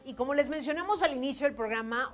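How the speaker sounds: radio announcer. Acted. Radio host announcing the break and return, upbeat. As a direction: sell the break and the comeback.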